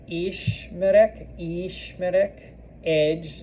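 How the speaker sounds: stretched first syllable, then the rest; slow model word. Shown as a demonstration. Teacher breaking down a new verb, slowly. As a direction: draw the word out for learners.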